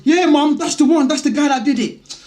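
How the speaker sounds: put-on high, whiny boy pointing me out; boy's voice. Boy telling his mum who hit him, high and whiny. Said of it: "and he goes".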